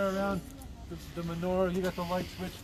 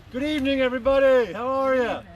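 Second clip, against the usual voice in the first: loud greeting; 6 semitones higher, 9 dB louder than usual. Real, not acted. Greeting a crowd at event.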